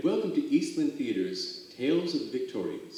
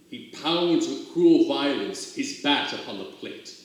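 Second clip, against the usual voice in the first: rolling, theatrical verse reading; dramatic poem recital; 3 semitones higher, 7 dB louder than usual. Acted. Reader performs Casey at the Bat for listeners, theatrical recital. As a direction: declaim the ballad for the audience.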